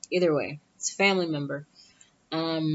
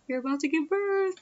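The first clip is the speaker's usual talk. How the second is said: high-pitched gushing imitation of mom; imitated thrilled mother, voice pitched way up; excited mom; 12 semitones higher than usual. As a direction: squeal it with anticipation. Mom excited in elevator, retold.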